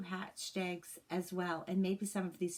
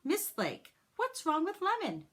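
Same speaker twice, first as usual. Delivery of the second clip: put-on worried child; 10 semitones higher, 5 dB louder than usual. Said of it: "Myra asked".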